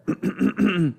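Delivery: exaggerated pig throat-clearing; long, rattling ahem. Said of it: "cleared his throat".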